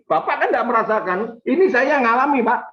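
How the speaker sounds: high imitation of an indignant complaint; aggrieved protest. Congregant tells pastor he cannot feel it, aggrieved. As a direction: protest as the one who suffered it.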